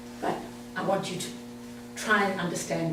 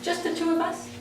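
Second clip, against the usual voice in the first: surprised; 10 semitones higher, 4 dB quieter than usual. Real, not acted. Few hands raised for bipolar.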